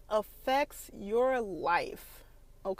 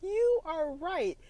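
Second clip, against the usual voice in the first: slow concession; 10 semitones higher than usual. Retold or imitated. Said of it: "You know what".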